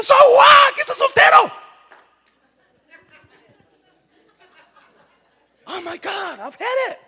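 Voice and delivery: high, loud, fed-up shout; exasperated shout. Acted. Pastor fed up with complainers, shouting. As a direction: throw up your hands, done.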